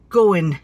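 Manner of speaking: "Going" ending in "in"; dropped G. Retold or imitated. Wife imitates friend Barbara, "Going" without the G. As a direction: say "Going" as "goin". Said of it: "miss the G of letter".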